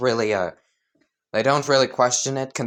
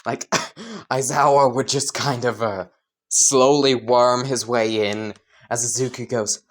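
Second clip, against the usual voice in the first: ordinary delivery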